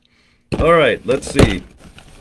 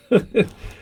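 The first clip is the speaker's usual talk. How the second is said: laugh; 13 semitones higher than usual. Real, not acted. Unsure he can show it.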